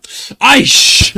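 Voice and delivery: loud, high whispered "Aish!" after a shot; hammed-up K-drama toast exclamation; mock drama drinking cry. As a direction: throw back the shot and hiss it out. Imitating drama soju shot cry.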